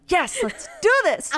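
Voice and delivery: high, pumped-up yes; fired-up competitive side; competitive cheer. Retold or imitated. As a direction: psych yourself up for a contest. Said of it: "My competitive side was like".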